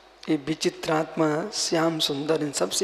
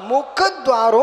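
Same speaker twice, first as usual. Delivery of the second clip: raised, louder call for repetition; full force; 6 semitones higher, 8 dB louder than usual. Shown as a demonstration. Monk has the congregation repeat the sutra, full force. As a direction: lead the crowd at full voice. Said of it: "बोलो पूरी जोर से".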